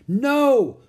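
loud high desperate no; cried out. Acted. Annie seeing leopard near baby, loud high cry. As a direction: scream it to save the baby. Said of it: "cried Annie".

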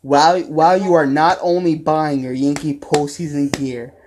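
podcast sponsor spot; ad read. Acted. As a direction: sell the hoodie deal to the fans. Promo code ad read.

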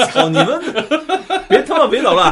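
loud, high-pitched shout over laughter; mock scolding. Real, not acted. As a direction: playfully yell at friends to quit it. 笑闹中喝止同伴捣乱. (笑着高声喝止)

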